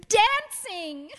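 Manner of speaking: high, eager exclamation; excited. Acted. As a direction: blurt it out with delight. Mother excitedly proposes dancing.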